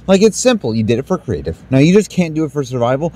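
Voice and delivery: ordinary delivery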